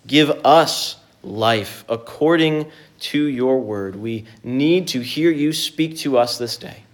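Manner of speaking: ordinary delivery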